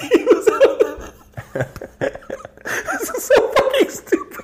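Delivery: squealing high through laughter; helpless laughter. Real, not acted. Host cracking up at his own silly character, helpless laughter. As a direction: try to talk while cracking up.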